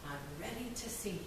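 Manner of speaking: very soft, low man's voice; quiet, intimate readiness; hushed lover. Acted. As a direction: say it low and hushed, with a pause before the last word. Acted lover, hushed and intent.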